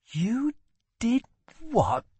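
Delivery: high, hushed disbelief with gaps; stunned, halting horrified question; horrified whisper. A narrator's reading. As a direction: breathe it out high, appalled. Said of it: "he whispered, horrified".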